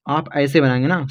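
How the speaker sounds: usual speaking voice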